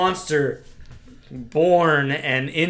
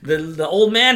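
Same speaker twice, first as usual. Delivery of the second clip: ordinary talk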